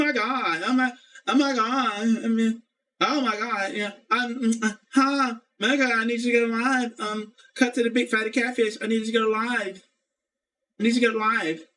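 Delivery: high mocking valley girl pleading; valley girl impression. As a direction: camp up her valley-girl begging. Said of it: "like she's a, a valley girl".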